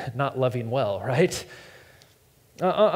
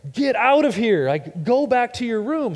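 exasperated dad; 7 semitones higher, 3 dB louder than usual. Retold or imitated.

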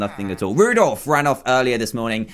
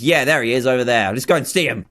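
normal talking voice

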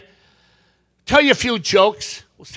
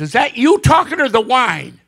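raised, louder acted challenge; questioning husband; 5 semitones higher, 5 dB louder than usual. Husband puts phone down to ask wife, raised pointed question. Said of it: "Husband puts the phone down and says".

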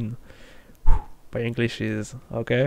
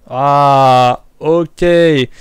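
dawning joy; 8 dB louder, slower than usual. Real, not acted. Solves the phone buzz mystery.

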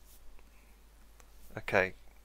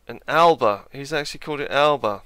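louder, higher, faster disbelief; a quick, loud burst of amused disbelief; surprised; 4 semitones higher, 10 dB louder than usual. Real, not acted. Player reacting to the Scots calling it Alba, surprised.

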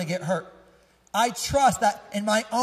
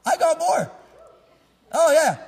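I got more shouted high and loud; excited shout; 9 semitones higher, 9 dB louder than usual. Shown as a demonstration. Preacher whipping up the crowd, excited loud shout. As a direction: burst out with it, thrilled, much higher and louder.

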